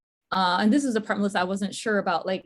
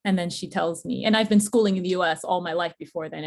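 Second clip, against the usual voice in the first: ordinary delivery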